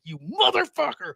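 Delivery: high, drawn-out indignation; retold anger. Insulted debater remembering the remark, high slow acted anger. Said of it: "I was so mad".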